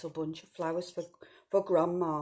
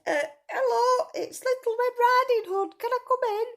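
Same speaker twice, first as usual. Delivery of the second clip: much higher, louder put-on little girl voice; wolf faking a girl; 7 dB louder than usual. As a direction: squeak like a wolf pretending to be a child. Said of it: "And he said".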